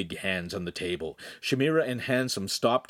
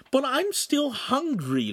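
much higher, slow plaintive whine; whining again; slower than usual. Acted. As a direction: plead for food like a child. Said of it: "Lincoln began".

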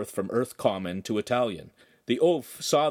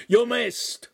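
high, loud taunt; mocking yell; 14 semitones higher, 5 dB louder than usual. Oaf taunting the man at the window, loud. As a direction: shout to mock the thrower. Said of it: "he cried".

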